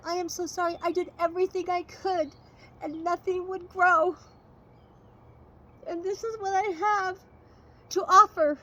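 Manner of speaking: put-on a frightened boy owning up; tearful apology.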